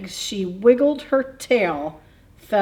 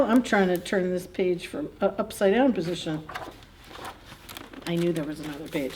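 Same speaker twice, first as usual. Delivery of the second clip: plain reading voice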